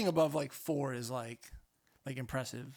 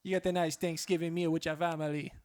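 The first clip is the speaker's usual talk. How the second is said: wobbly Jamaican drifting into Irish; bad Jamaican accent. Shown as a demonstration. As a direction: do Jamaican that nearly turns Scottish. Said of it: "That was just still shitty Jamaican".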